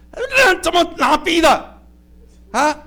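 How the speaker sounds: high, slow scolding question; scolding parent. Retold or imitated. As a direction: scold the child for one B.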